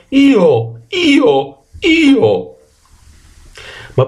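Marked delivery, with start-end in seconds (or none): 0.1-2.6 donkey bray. A narrator's reading. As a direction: bray each word like a donkey that lost its voice. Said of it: "perse la voce". (raglio d'asino)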